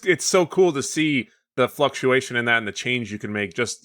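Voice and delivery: usual speaking voice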